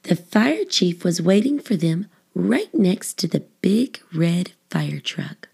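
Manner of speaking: usual speaking voice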